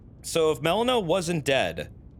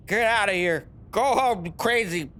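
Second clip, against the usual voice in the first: higher put-on voice, shooing someone away; hammed-up indignant dismissal; mock scolding; 7 semitones higher than usual. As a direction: send them packing in a silly high voice. Acting out Melina's scolding dismissal.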